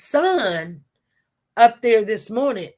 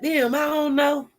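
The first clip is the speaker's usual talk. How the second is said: higher, exasperated imitation of a boy; mock agitation, pitched up; agitated; 7 semitones higher than usual. Confused boy on the stand to lawyer, agitated. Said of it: "He was getting agitated".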